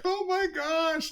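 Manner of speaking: high, slow, overwhelmed fan voice; mock tearful. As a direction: imitate someone moved to tears. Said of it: "crying. She's just like".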